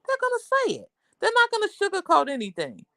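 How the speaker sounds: high-pitched, insistent quoted advice; emphatic retold warning. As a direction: jump up high in pitch to drive the warning home. Retold niece's blunt warning about New Yorkers.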